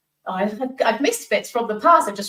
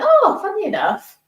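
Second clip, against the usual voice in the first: high, loud "oh" of coincidence; retold surprise; 13 semitones higher, 6 dB louder than usual. Woman recalls answering his wish for garden work, pleasantly surprised. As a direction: leap up high as if struck by the coincidence. Acting out her surprised reply.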